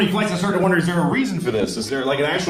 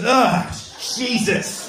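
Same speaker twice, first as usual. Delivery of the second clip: weary groan; 9 semitones higher, much slower than usual. Retold or imitated. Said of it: "Their whole life was".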